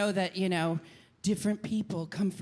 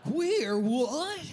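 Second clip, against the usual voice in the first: baffled brain; 6 semitones higher than usual. Retold or imitated. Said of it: "your brain's like".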